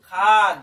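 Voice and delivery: খান called out loud as one syllable; loud teacher's model of খান; pronunciation demo. Shown as a demonstration. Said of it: "শুদ্ধ উচ্চারণ হয় খান্ট". (জোরে খান উচ্চারণ করে দেখানো)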